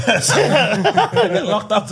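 slow, laughing through it; real laughter over the repeat. Real, not acted. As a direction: stretch it out, giggling.

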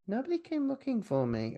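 high, soft mock complaint; mock plaintive. Repeats character's line in put-on voice.

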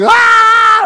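high, loud panicked shriek; hammed-up scream of panic; mock scream. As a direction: scream like you're freaking out. Freaking out.

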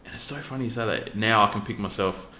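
usual speaking voice

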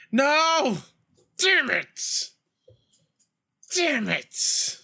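high-pitched cry of real frustration; frustrated outburst. Real, not acted. Gamer watching his play fail, frustrated high outburst. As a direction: burst out high, exasperated at losing.

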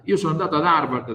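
ordinary talk

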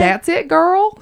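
high, loud, whooping Southern drawl; Southern accent. Pretend. Host cheers co-host's right answer in a Southern accent. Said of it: "in my Southern accent".

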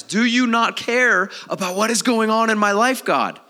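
loud, high-pitched protest; acted anguished challenge, dropping on the last word; exasperated cry to God. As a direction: call God out in frustration. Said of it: "Like, I've said that many times".

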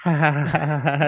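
weak, forced ha-ha-ha; put-on sheepish laughter; mock awkward laugh. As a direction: imitate a slumped contestant's uneasy laugh. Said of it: "they're just kinda slumped over, like".

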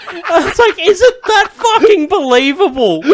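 incredulous, laughing hard. Real, not acted. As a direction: crack up while asking.

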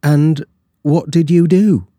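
eager to hear the tale; curious servant. A narrator's reading.

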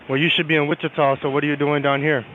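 ordinary talk

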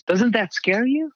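high, slow, alarmed friend's voice; mock-worried friend, high and drawn out. Friend asks about the diagnosis.

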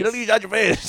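high alarmed quote; shocked dad. Retold or imitated. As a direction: replay dad's horror. Repeating dad's alarmed quote.

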